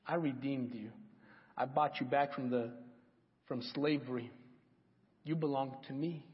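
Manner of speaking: soft, low, intimate address as God; quietly reassuring God voice; tender voice of God. Acted. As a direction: speak it gently, like a father claiming his child. Voicing God tenderly to listeners.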